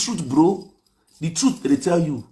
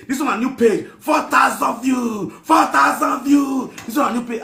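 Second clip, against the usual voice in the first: mock screaming; 8 semitones higher than usual. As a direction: shriek it like the excited girl. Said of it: "She was screaming of four thousand views".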